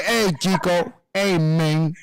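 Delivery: low, drawled mock Cuban-tough-guy accent; Razor Ramon impression. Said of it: "he'd be like".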